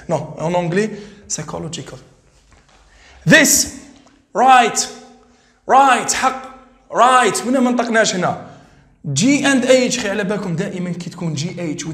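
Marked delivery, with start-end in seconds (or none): 4.3-7.5 Right said loud as rait, gh silent; model pronunciation. Shown as a demonstration. Teacher drills Right for the class, loud and short. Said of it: "teacher models silent gh".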